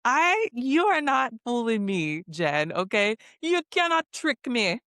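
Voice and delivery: high, laughing, you can't fool me; laughing call-out. Real, not acted. To Jen on the show, a high laughing call-out. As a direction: call her bluff between laughs.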